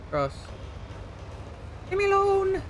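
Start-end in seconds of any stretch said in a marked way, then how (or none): 1.9-2.6 high, fast put-on scold; hammed-up high scold; mock scolding. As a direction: sass the pedestrian in a high voice. Mock scolding game pedestrian.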